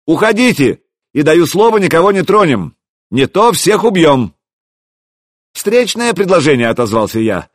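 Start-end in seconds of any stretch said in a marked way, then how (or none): 0.1-4.3 high, raised threat; shouted ultimatum. A narrator's reading. Besieging prince threatening the defenders, shouting. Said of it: "крикнул он". 5.5-6.7 high, raised reply; calling back. A narrator's reading. Hero answering the prince's ultimatum, calling back.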